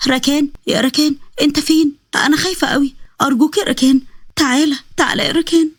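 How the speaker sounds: high, frightened calling out for someone; acted high pleading voice; tearful fear. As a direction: call for help, scared and tearful. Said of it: "صحيت وقعدت تعيط".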